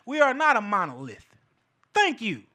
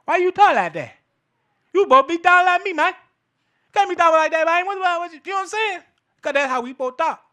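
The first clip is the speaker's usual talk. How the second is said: high, loud, rapid-fire complaint; pitched-up, pushy mock protest; mock indignant heckler; 7 semitones higher, 5 dB louder, faster than usual. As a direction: imitate a riled-up objector. Mimics people objecting to proper talk.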